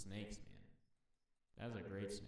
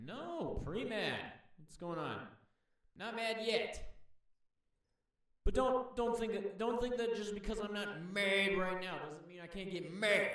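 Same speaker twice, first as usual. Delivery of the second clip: high, loud mock indignation; touchy snake, pre-mad; 9 semitones higher, 5 dB louder than usual. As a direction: voice an indignant snake building toward a threat. Voices snake not mad yet.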